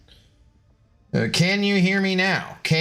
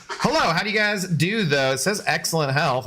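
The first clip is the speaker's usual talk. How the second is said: usual speaking voice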